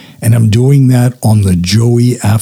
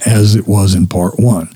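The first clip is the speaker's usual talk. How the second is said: usual speaking voice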